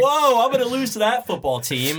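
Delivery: high, loud, laughing through the words; can't stop laughing; after laughing hard. Real, not acted.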